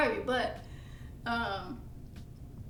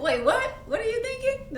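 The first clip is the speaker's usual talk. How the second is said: startled disbelief; 11 semitones higher, 5 dB louder than usual. Retold or imitated. Echoing the confused reaction.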